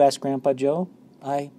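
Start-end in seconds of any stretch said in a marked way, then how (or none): 1.2-1.5 higher, hesitant, mild stammer. A narrator's reading. Boy unsure how to answer Grandpa, mild hesitant stammer. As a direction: hesitate after the first word, unsure. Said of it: "Charlie stammered".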